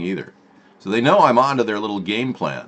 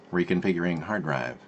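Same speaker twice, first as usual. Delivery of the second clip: ordinary delivery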